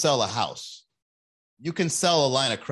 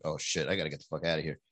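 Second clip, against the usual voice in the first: fast, much softer panicked mutter under the breath; 9 semitones lower, 8 dB quieter, much faster than usual. Retold or imitated. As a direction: mutter it fast as you flee. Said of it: "I was like".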